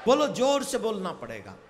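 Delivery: loud, ringing call for a vow; rousing call. Real, not acted. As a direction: rouse the whole tent. Preacher rousing crowd to vow. (कथा पंडाल को ज़ोर से प्रण के लिए ललकारना)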